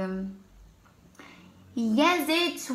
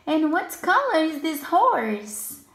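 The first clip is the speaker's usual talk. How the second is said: ordinary delivery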